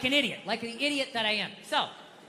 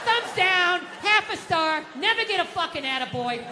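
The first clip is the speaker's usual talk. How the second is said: mock bad review; 7 semitones higher than usual. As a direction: read it out like a scathing Yelp review, a bit higher. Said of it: "bad reviews on the fucking husband Yelp".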